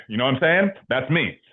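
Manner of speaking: normal talking voice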